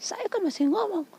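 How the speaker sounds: very high-pitched mock tirade; imitating scolding caller. Caller berates her for hanging up, mock outraged. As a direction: scold like the affronted caller, voice way up but not shouted. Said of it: "Dia maki saya".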